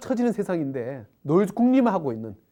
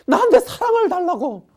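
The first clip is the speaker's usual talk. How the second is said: mock pleading prayer; 10 semitones higher, 5 dB louder than usual. Man prays for love, mocked.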